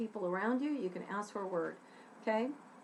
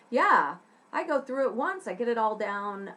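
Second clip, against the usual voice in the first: usual speaking voice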